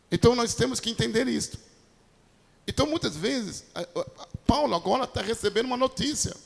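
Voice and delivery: ordinary talk, nothing marked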